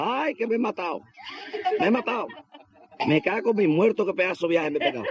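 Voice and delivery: high, wailing cries of a man who fell; mock injured builder crying out; pained worker yelling. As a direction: howl it like you just crashed through the boards. Fallen worker screaming in pain.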